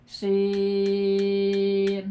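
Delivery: "Sin" with a long, level held vowel; six-count long Sin. Shown as a demonstration. Teacher demonstrating six harkat to students, "Sin" drawn out evenly. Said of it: "Enam harkat".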